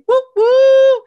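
loud high whooping; host hyping up his guest; double hype woo. Real, not acted. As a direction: cheer the guest in. Introducing guest with cheers.

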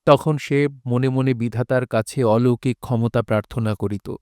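ordinary talk

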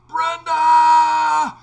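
very loud, high shout; husband yelling for his wife inside the store, very loud; yelling Brenda. Retold or imitated. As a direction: holler her name across the gas station. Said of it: "I yelled so loud that she heard me in the store".

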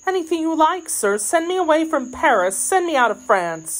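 high, pleading maid's voice. Acted. Kitty begging to be sent out of France, high and pleading.

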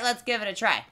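usual speaking voice

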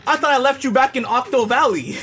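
high-pitched, happy surprise; delighted greeting. Real, not acted. Streamer welcoming little buddy from Octo Valley, delighted.

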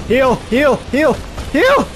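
rising frantic pleas; panicked repeating. Real, not acted. Panicking low health.

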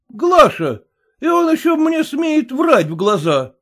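husband protesting to his wife, outraged shout. A narrator's reading. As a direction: burst out at the lie.

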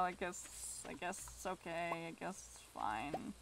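soft, low, trailing concession; grudging resignation. Real, not acted. Player accepts a spoiler they wanted to find, grudging resignation. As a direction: give in quietly, sinking in pitch.

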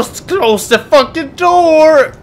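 slow drawn-out yell. Real, not acted. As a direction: drag the yell out, leaning on door. Player yells it again, drawn out.

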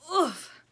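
a short strained oof of effort; effort grunt. A narrator's reading. Buffalo pushing the fridge dish, strained grunt. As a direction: grunt as he shoves hard.

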